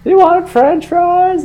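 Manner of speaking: high, drawn-out mock yell; mock mom yell. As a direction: imitate a mom calling downstairs, high and slow. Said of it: "she's just yelling".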